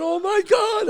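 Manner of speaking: high, loud cry of terror; panicked cry. Retold or imitated. Sunbather told to run, panicked cry. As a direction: cry out high in mock horror.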